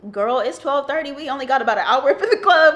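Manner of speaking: mock-dramatic gripe about the club closing early; playful loud diva whine; joking sassy complaint. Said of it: "me and Jaylee make a joke".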